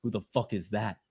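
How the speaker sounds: usual speaking voice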